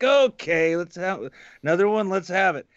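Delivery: a hint of a merry drinker; tipsy Lacey. Imitating a tipsy Lacey calling for another round. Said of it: "She's like".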